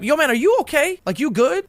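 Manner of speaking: asking a stranger if he's okay, voice up; concerned check-in. Retold or imitated. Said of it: "I was like".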